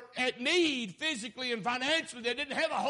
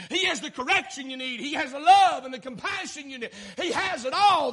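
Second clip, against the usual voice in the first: loud, rising preacher's shout; hollering gospel preaching; full-force preaching; 4 semitones higher, 7 dB louder than usual. Acted. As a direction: preach it to the rafters.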